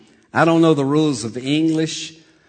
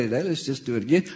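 ordinary delivery

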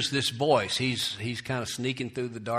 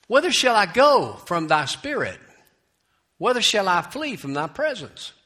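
raised, ringing verse reading; scripture reading; 7 semitones higher, 5 dB louder than usual. Acted. Preacher reads David's psalm to congregation, declaimed scripture.

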